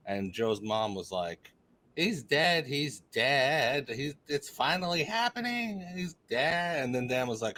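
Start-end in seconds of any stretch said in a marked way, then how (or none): 2.0-6.8 higher, hammed-up mom lament; mock mournful announcement of a death; imitating a distraught mom. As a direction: retell her alarm in a raised, drawn-out voice. Mom's wail in retold story.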